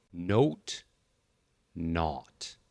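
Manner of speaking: "Note" rounded gliding o, "not" open aw; note versus not. Shown as a demonstration. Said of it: "let's start with".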